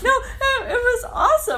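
high-pitched laughing protest. Real, not acted. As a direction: talk through real laughter.

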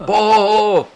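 high, loud, laughing holler; imitated crowd shout. Imitating the whole bar seeing a loose ball, laughing shout. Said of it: "The whole bar".

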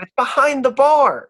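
high and loud, exasperated; indignant barmaid. Acted. Barmaid to the sneaking player, loud and high. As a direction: throw the fact at him.

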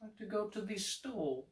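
high, soft pleading voice; put-on little voice. Shown as a demonstration. Trying an example sentence, in a high put-on voice. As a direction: speak up high and very softly.

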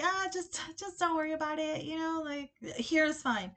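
light, high, hurried deflection; casual evasion. Retold or imitated. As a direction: act out politely dodging the question. Retold dodge about where car is.

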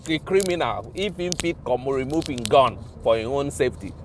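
ordinary delivery

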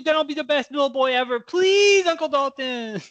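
fast, high, whiny begging; high pleading whine. Acted. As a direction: plead like a spoiled kid. Teen keeps begging uncle.

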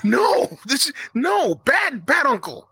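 laughing half-shout of bad, bad uncle; scolding uncle. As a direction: scold him like a naughty dog.

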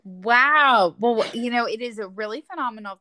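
loud, impressed wow; really wowed by it; amazed. Real, not acted. Host amazed at busy schedule.

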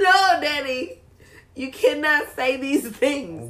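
high laughing protest, slowing down; laughing, half-pleading scold. Real, not acted. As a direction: scold your dad through laughter. Scolding dad while laughing.

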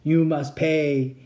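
very slow mechanical monotone; drawn-out, level robot chant; stuck robot repetition. A narrator's reading. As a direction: repeat it like a broken machine. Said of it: "he kept repeating as if the words were".

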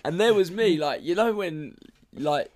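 ordinary talk, nothing marked

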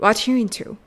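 "What you into" said as "wa-chu intu"; "What you" fused with a ch sound, running into "into"; linked, reduced words. Shown as a demonstration. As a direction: swallow "are" so "What you" becomes "whatchu". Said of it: "と聞こえています".

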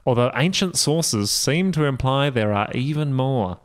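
ordinary delivery

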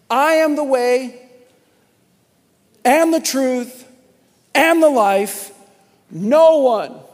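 slow, loud, drawn-out scripture reading; forceful preaching. Acted. Pastor booms the verse to the congregation, slow and loud. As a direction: proclaim each phrase to the church.

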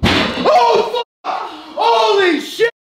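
high, loud startled cry; startled shout. Real, not acted. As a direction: yell at the sudden bang. Jawbreaker explodes in microwave.

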